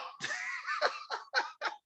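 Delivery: a sudden squeal of a laugh; real amused cackle; high burst of laughter. Real, not acted. Laughing at friend's lie.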